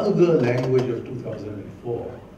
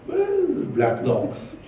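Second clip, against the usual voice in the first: mocking; much slower than usual. Real, not acted. Mocking Sushma's hair.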